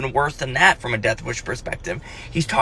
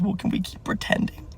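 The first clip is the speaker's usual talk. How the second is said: tearful; 6 semitones higher, 8 dB quieter than usual. Retold or imitated. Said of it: "she's wiping her eyes".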